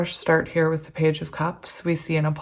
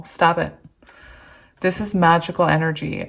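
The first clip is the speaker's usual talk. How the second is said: usual speaking voice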